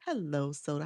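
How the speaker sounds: normal talking voice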